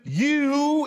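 "you" said high and pointed; accusative you. Shown as a demonstration. Preacher stressing the accusing "you", high and pointed. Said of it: "you could hear that accusative".

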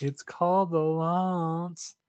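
slow, drawn-out mock-solemn line; movie quote. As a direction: say it grandly, dragging it out. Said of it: "I went".

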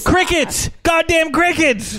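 high, exasperated yelp; exasperated outburst. Real, not acted. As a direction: squeal it out in disgust. Complaining about bugs at home.

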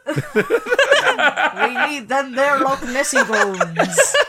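loud, slow, laughing stab at Scottish; attempted Scottish accent. Podcaster playing an oilman after Nessie's bones, bad Scottish accent. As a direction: drag out a hammed-up Scottish oilman. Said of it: "I can't do a Scottish accent".

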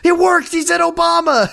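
high-pitched, thrilled shouting; hammed-up ecstatic squeal; overjoyed bird owner. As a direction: gush as if announcing a miracle. Acting excited bird owner.

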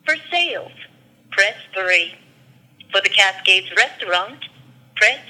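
phone menu voice. Real, not acted. Automated hotel phone menu plays.

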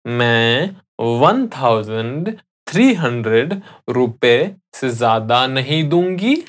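chunk by chunk, with pauses; slow teaching repeat of the sentence; slowly. Shown as a demonstration. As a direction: break the sentence up for a learner. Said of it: "I'll say that again slowly".

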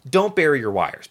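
ordinary delivery

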